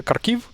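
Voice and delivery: Kharkiv as kar-KIV, hard K first, second syllable stressed; the mistaken kar-KEEV for Kharkiv; wrong hard K. Shown as a demonstration. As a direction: open Kharkiv on K and lift the -kiv. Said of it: "it's not".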